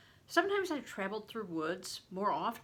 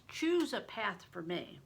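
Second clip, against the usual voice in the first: ordinary delivery, nothing marked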